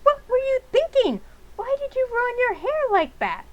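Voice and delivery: horrified mom scolding; shocked scolding. Acted. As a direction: scream it at your daughter. Mother scolds haircut.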